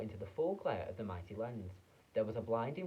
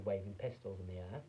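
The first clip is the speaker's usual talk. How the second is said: ordinary delivery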